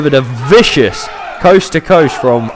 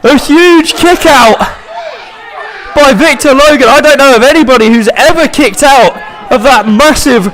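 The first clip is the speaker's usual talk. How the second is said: wrestling commentator; 11 semitones higher than usual. Acted. Live wrestling play-by-play.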